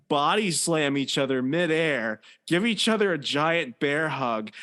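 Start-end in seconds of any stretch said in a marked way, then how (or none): none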